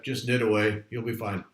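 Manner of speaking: ordinary talk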